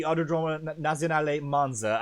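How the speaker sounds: hammed-up Italian accent. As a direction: roll the name out Italian-style. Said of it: "I am terrible at an Italian accent".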